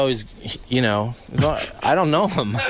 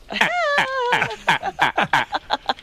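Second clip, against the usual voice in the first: high falsetto laugh. Retold or imitated. Leno bit ends in big laugh.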